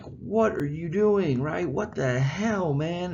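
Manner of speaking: high, slow, frantic Wilhelm; mock panicked king, high and drawn out. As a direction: freak out like a scared king. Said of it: "He is like".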